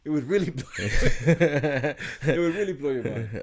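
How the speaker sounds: words broken by real laughter; giggling while teasing the secret; laughing. Real, not acted. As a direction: laugh through it, stretching it out slowly.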